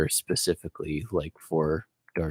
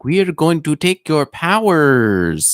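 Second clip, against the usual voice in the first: higher, louder stilted alien announcement; Glove Guru voice; 8 semitones higher, 7 dB louder than usual. Retold or imitated. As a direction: declare it like an invading alien. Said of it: "Why are they speaking like Glove Guru?".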